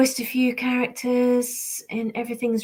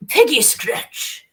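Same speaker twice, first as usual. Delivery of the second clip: forceful character voice, higher and louder; Peggy Scratch; 6 semitones higher, 5 dB louder, much slower than usual. Shown as a demonstration. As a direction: punch the name out slowly and forcefully. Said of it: "is a bit more forceful".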